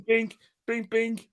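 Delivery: high mimicked notification pings; ping it like a phone going off, pitched high; phone ping imitation. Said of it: "all I hear is bing".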